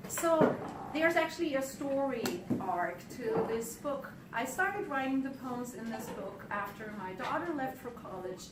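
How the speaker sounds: usual speaking voice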